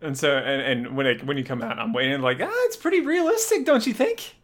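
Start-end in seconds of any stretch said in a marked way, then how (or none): 2.4-4.4 much higher, upbeat gamer voice; chipper in-character remark. Acted. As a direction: pipe up high, pleased with the game. Playing gamer waiting outside, in character.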